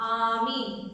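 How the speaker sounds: ordinary talk, nothing marked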